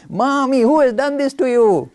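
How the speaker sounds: put-on high, loud little boy, ready to fight; a fierce tiny child demanding who hurt his mother. Little Ganesh to his mommy, high and fierce. Ganesh's line repeated, in character.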